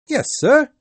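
put-on a quick, keen boy's reply; young boy. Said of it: "said the boy".

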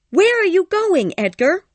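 very high, loud call after him; crying out. A narrator's reading. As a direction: call out as he heads for the door. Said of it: "cried Cathy".

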